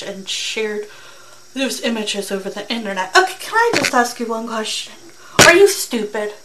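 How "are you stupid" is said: loud, incredulous: are you stupid; exasperated outburst. Real, not acted. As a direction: blurt it out in disbelief. Reactor scolds the criminal.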